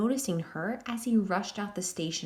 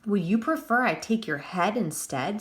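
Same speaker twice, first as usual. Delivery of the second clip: ordinary delivery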